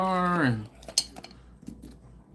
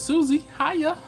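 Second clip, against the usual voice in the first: chirpy greeting; 14 semitones higher, 3 dB louder, slower than usual. Retold or imitated. Voicing a viewer's chat greeting.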